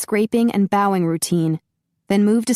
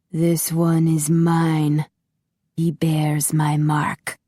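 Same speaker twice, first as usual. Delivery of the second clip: slow, muffled, menacing master; muffled masked villain; 2 semitones lower, much slower than usual. A narrator's reading. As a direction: speak slowly behind a mask. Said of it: "his muffled voice".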